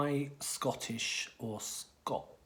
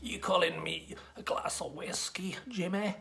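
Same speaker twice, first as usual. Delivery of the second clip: mock Glaswegian hard man, high and edgy; a drunk Glasgow tough taking offence; Scottish accent; 6 semitones higher than usual. To a stranger on the street, a mock angry Scottish challenge. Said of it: "are they worried that they're going to be taken for".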